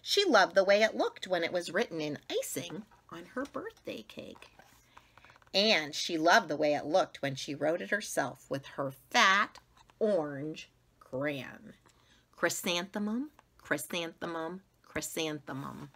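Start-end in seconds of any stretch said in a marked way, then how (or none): none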